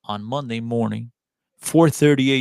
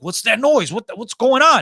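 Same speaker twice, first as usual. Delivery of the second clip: startled players; 8 semitones higher, 4 dB louder than usual. Retold or imitated. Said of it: "They're like".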